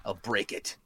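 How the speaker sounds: low, soft menacing growl. Acted. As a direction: threaten the dealer under the breath. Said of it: "he growls".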